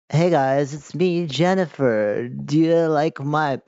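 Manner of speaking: hammed-up slow character greeting; put-on character voice. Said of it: "I don't know why she talks like this".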